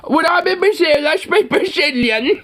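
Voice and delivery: high, dopey know-it-all voice; mock tourist. Parodying a clueless tourist, high and dopey. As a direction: play a clueless tourist bragging. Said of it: "no, you fucking don't".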